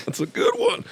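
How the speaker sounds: very high squeaky "good one"; high-pitched, rising pat on the back; mock reassurance. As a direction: squeak it out while laughing. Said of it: "I'm looking back at Joe like".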